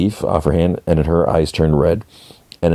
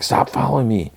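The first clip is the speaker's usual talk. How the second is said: mock irritated demand; 7 semitones higher than usual. [name] tells his follower off, mock irritated. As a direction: imitate someone fed up with being tailed. Acted annoyed demand before hissing.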